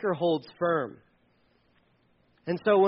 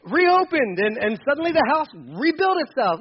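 higher, louder mock-cheery wishful voice; mock naive optimism; 5 semitones higher, 5 dB louder than usual. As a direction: play the gullible believer, hammed up. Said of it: "Like, no".

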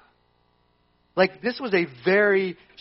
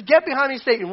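higher, louder firm command; Jesus rebukes; 7 semitones higher, 4 dB louder than usual. Retold or imitated. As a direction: banish him with force. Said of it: "He says".

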